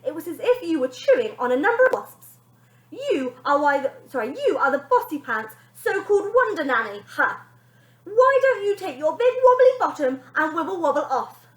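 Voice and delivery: high, loud cheeky insults; rude bossy girl. Acted. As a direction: fire insults like a spoilt child.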